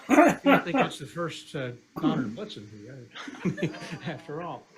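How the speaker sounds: slow punchline. Acted. Punchline delivered drawn out.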